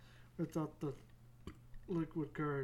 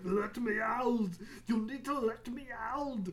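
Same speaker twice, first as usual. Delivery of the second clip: mock desperate yell; 6 semitones higher, 4 dB louder than usual. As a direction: cry out as if trapped, hammed up. Acted plea, trapped on bus.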